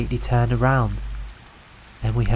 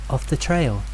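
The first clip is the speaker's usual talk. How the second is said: ordinary delivery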